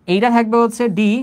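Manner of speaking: ordinary talk, nothing marked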